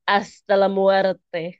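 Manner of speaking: drawn-out ominous reading; slow, dramatic. Retold or imitated. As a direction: read it slowly and ominously.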